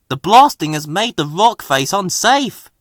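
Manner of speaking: calling a warning. A narrator's reading. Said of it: "he called to them".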